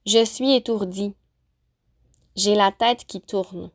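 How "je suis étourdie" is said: ordinary talk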